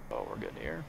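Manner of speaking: ordinary talk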